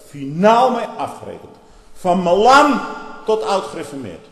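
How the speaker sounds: loud, ringing pulpit shout; preacher shouting his outrage at the churches; fiery preaching shout. Real, not acted. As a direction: thunder it at the congregation.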